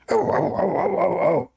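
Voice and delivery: very high frantic yaps; chihuahua yapping. Retold or imitated. Retelling a dog fight, high mock chihuahua yelps. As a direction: yelp like a furious tiny dog. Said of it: "And the Chihuahua, even he, he flip".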